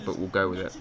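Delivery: ordinary talk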